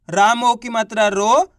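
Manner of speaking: slow spelling chant, रो stretched and rising. Shown as a demonstration. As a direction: spell it for beginners, drawing out रो. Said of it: "देखो किस तरीके से है". (हिज्जे करते हुए, रो को खींचकर)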